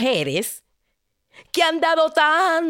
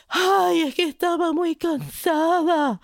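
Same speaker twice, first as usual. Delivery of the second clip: whiny maiden; 6 semitones higher than usual. Acted. Maiden excuses herself.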